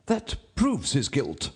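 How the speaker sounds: high, slow verdict; queen. A narrator's reading. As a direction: pronounce guilt slowly as the queen, high. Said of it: "said the queen".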